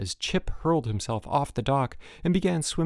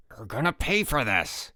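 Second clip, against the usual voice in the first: through clenched teeth; 3 semitones higher than usual. A narrator's reading. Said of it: "through clenched teeth".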